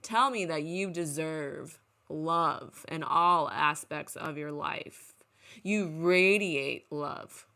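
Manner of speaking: slow, loud, insistent urging; intense coaching. Acted. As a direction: drag it out and push each word like a pep talk. Urging the kid to say it.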